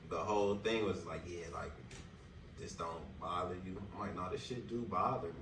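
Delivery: normal talking voice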